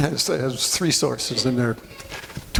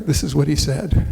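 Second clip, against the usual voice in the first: ordinary talk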